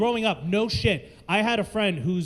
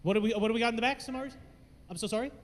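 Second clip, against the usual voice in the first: usual speaking voice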